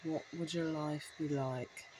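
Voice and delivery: slow, calm hypnotic voice; slow, mildly hypnotic commercial voice. Retold or imitated. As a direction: ease it out like a soothing TV hypnotist. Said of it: "she speaks really calm and slowly".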